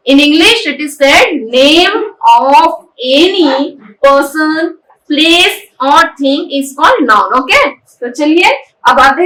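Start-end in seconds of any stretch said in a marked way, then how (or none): 1.5-7.4 very slow, word-by-word delivery; slow dictation. Shown as a demonstration. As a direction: dictate it to the class one word at a time. Teacher dictating the English definition.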